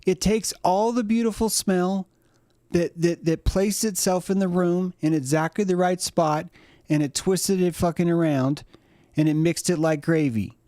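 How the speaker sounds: high-pitched dog lecturing its owner; talking dog. Acted. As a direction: complain in a high cartoon dog voice. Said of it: "Dog's like".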